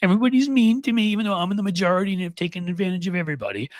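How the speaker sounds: high, fast mock whining; hammed-up high crybaby whine; whiny crybaby. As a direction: whine it like a sulking child. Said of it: "Cry, whine".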